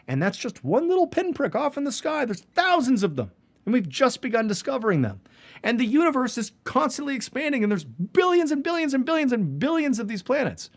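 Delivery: high-pitched, insistent wonder; amazed excitement. Real, not acted. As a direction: pile up the numbers, voice soaring. Marvelling at the planet discoveries.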